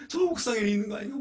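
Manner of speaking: high, fast, frantic question; father searching the stairs in a panic; panicked question. Retold or imitated. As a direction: blurt it out in panic.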